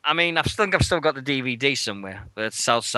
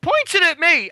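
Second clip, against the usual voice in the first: ordinary talk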